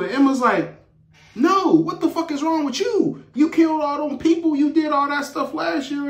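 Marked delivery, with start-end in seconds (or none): 1.4-6.1 high-pitched acted outburst; indignant. Emma confronting Sam over what he did, indignant. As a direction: fire back in heated, high-pitched disbelief.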